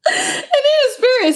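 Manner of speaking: said through laughter, slow and high; laughing. Real, not acted. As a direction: talk while still cracking up. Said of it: "laugh cry".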